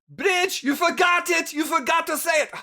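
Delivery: high, loud shouted reminder; owner calling out. Acted. Owner yelling to daughter who forgot greeting, shouted. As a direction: holler across the room at the daughter. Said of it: "you hear from the, the owner".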